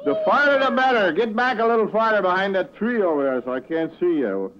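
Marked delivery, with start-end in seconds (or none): 0.0-3.0 loud joking heckle. Acted. Host heckles singing puppet.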